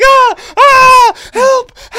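high, loud fake screams for help; mock cries for help. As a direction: ham up a fake struggle. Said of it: "She'd be like".

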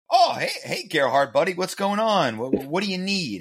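lightly acted eager coworker; eager employee. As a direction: gush like a friendly colleague eager to please. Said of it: "You're gonna go".

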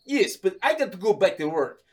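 mock Russian insisting; Russian accent. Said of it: "this Russian guy".

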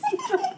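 squealing burst of laughter; high squeal laugh. Real, not acted. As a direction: let out a shrill giggle.

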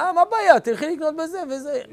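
high, loud mock brush-off; mock husband breezily solving her problem; dismissive husband. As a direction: toss off a quick fix like a clueless husband. Husband voiced, brushing off wife.

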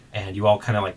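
ordinary delivery

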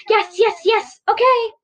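high, eager rapid-fire agreement; excited. A narrator's reading. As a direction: burst out with delighted yeses. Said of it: "Becca was so excited".

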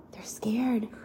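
whispered, wide-eyed; hushed. Acted. Parent whispering to the child about the scared animals. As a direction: whisper it like a spooky secret.

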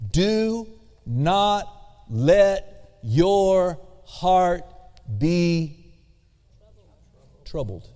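deliberate imperative, fading on the last word; slow emphatic command. Shown as a demonstration. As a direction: spell it out slowly, word by word. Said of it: "It's emphatic. It's an imperative statement".

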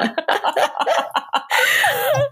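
high rising laugh; a long giddy laugh; giddy laughter at her own joke. Real, not acted. Laughing at herself.

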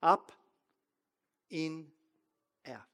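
each word spaced out with long pauses. Shown as a demonstration. Preacher slowing down so notes can be taken, slow. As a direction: say it slowly for note-takers. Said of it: "I'll say it slower".

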